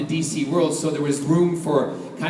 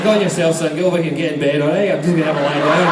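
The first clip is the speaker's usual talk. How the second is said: broad Scottish bedtime chivvying; Scottish accent; 4 dB louder, much faster than usual. Shown as a demonstration. Telling Scott to get to bed, Scottish accent. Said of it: "when I start talking like this".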